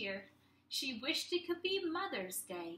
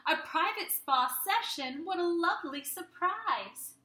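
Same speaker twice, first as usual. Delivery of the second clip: higher, louder delighted voice; delighted mom; 5 semitones higher, 4 dB louder than usual. Acted. Mom thanking the kids for the spa. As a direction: sound touched and surprised.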